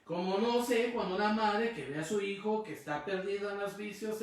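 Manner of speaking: normal talking voice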